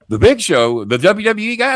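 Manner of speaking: high, excited gasp of recognition; a mock-awed hotel clerk; starstruck clerk. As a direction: play the dazzled clerk hearing the name.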